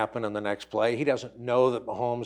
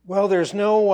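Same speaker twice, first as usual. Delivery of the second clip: choking on water; 8 semitones higher, 8 dB louder than usual. Real, not acted. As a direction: voice catching, water down wrong pipe. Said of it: "water went down the wrong pipe".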